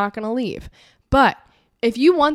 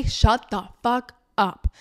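curt dismissal; slower than usual. Pretend. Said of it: "Or just say".

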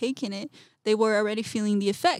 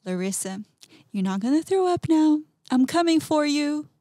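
higher and louder, a taunting inner voice; annoying taunting voice; 6 semitones higher, 4 dB louder than usual. Retold or imitated. Voice in her head taunting her, higher, louder, mocking. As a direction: tease her like a pest that won't leave. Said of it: "very annoying voice telling me".

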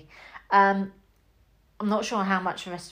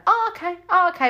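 much higher, delighted double "oh, okay"; surprised cameo reaction; 11 semitones higher, 5 dB louder, faster than usual. Retold or imitated. A viewer spotting a surprise cameo, pitched-up surprise. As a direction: caught off guard and pleased, twice over. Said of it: "you do kind of sit there and think".

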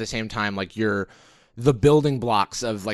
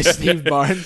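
trailer announcer voice; 5 semitones higher, 4 dB louder than usual. Pretend. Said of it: "movie trailer announcer".

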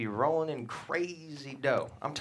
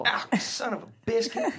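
higher, louder laugh through a silly curse; laughing mock curse; 10 semitones higher, 5 dB louder than usual. Dad laughing at his own shirt stain, silly curse.

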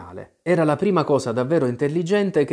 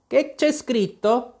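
imperious queen; 12 semitones higher, 3 dB louder, slower than usual. Acted. Queen demanding in court.

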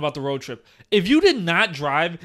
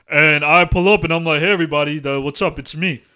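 low booming big-guy voice; deep voice; 4 semitones lower, 5 dB louder than usual. Retold or imitated. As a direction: drop into a deep chesty voice. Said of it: "Low ass voice".

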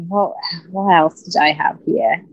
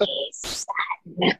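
whispered; 12 dB quieter than usual. Retold or imitated. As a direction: imitate Mark's whispered greeting. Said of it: "he whispers it".

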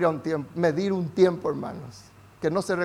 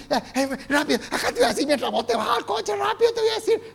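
much higher, fast, rushed caricature; mock hurried God; 12 semitones higher, faster than usual. Pastor mocks a hurried God for the church, high and rushed. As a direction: rattle it off like someone late for the car. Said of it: "Ese no es Dios".

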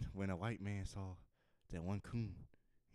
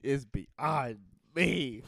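very slow, higher, loud delivery; mock alarmed character; 4 semitones higher, 8 dB louder, much slower than usual. Comedian plays a baffled person, theatrical and drawn out. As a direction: draw it out theatrically. Continuing guard-calling character.

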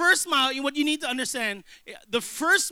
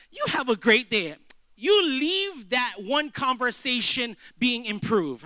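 ordinary talk

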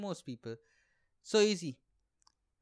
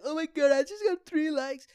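much higher and louder, gushing squeal; mock excited social media user; 10 semitones higher, 9 dB louder than usual. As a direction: squeal it like a giddy teen over three likes. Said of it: "and I'll be like".

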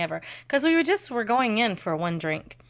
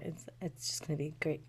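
whisper; 4 semitones lower, 13 dB quieter than usual. Real, not acted. Trailing whispered good times.

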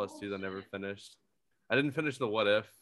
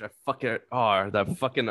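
normal talking voice

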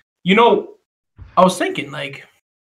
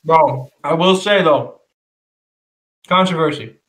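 talking through a mouthful of fries; chewing while speaking; mouth full. Real, not acted. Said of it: "Oh, sorry, I'm eating French fries".

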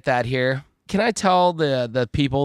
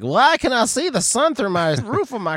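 high, mock-puzzled ditzy voice; mock clueless woman; 10 semitones higher than usual. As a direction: play the baffled driver, hammed-up. Mocking a clueless sunroof driver.

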